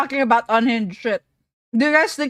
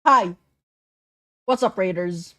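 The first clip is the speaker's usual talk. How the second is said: talking while eating; chewing noisily; 4 semitones lower than usual. Real, not acted. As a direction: speak through a bite of food. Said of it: "I'm sorry, I'm eating".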